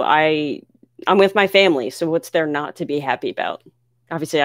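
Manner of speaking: ordinary delivery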